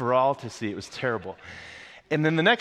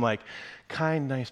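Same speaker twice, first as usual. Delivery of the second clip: ordinary talk, nothing marked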